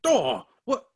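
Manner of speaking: sudden high, loud yelp of surprise; startled cry. Acted. Scrooge yelps, startled by the spirit's slap. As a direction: caught off guard by a slap.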